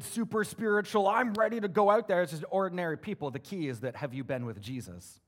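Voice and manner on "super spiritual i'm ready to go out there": higher, louder, hammed-up keen believer. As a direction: send up the overeager super-spiritual type.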